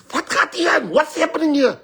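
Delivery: high, loud outraged shriek; angry wife. Retold or imitated. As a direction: act out a wife bursting in on a cheater. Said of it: "she was so angry".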